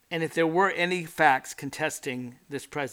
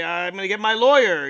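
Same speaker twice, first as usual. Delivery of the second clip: raised, indignant outburst at the police; mock scream; 3 semitones higher, 8 dB louder than usual. Guilty man yelling at police through the door, mock scream. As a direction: shout it out, affronted. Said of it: "You scream".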